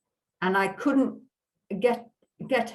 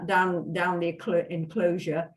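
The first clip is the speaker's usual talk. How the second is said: usual speaking voice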